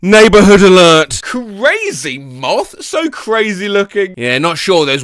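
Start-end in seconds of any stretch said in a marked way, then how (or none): 0.0-1.2 louder mock announcer; announcer call. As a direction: announce it like an alarm. Announcing each silly alert.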